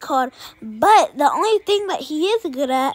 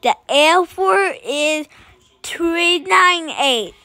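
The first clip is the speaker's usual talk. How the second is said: higher, slow, careful mock kid voice; imitating a boy; 7 semitones higher, 4 dB louder, slower than usual. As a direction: sound out each number slowly like a kid. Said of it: "he would be like".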